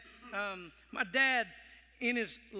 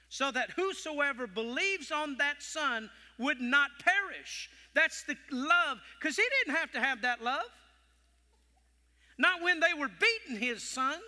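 preaching at full force; 4 semitones higher than usual. Acted. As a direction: pour the passion into it.

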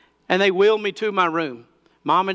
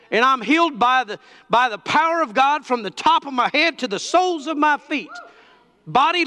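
raised-pitch, emphatic sermon delivery; fervent preaching; 9 semitones higher than usual. Real, not acted. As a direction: preach it with conviction, declaring to the congregation. Preaches forcefully to congregation.

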